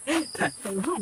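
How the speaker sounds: short high giggle at the flubbed take; embarrassed laugh. Real, not acted. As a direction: laugh it off after messing up the line. (natatawang hagikgik)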